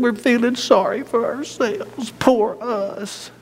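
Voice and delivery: high, soft, whiny pity-party voice; mock self-pity. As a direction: pout it out, poor us. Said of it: "What were they doing?".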